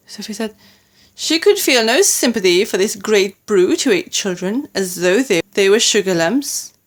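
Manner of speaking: ordinary delivery, nothing marked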